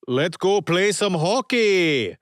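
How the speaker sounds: put-on slow, high and loud Canadian accent; rally the team in a hammy Canadian accent; thick Canadian accent. A narrator's reading. Sonny rallying friends for hockey in a loud Canadian accent. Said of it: "in a thick Canadian accent".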